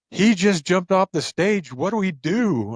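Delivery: mock alarm. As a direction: imitate a flustered assistant, stretching the words. Retold assistant alarmed at stage jump.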